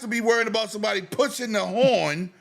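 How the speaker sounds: high, loud incredulous rant; exasperated shout. Real, not acted. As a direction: yell it in disbelief.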